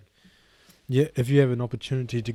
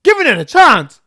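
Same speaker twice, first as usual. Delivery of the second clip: very high, loud scoffing echo; outraged disbelief; 16 dB louder than usual. Real, not acted. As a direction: throw her words back in shock. Outraged echo of her words.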